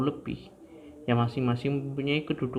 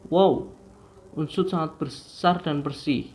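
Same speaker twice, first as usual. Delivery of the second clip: higher, bright-eyed wonder on each exclamation; demo exclamation; 4 semitones higher than usual. Shown as a demonstration. Teacher demonstrating exclamation sentences to students, excited and higher. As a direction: act out amazed delight for the class. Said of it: "memiliki intonasi yang terkadang tinggi".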